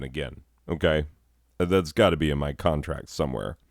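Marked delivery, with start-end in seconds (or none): none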